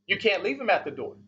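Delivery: ordinary talk, nothing marked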